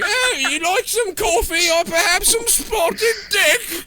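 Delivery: high, slow hammed-up English accent; a silly English host offering dessert; mock English accent. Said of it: "you know how those English are".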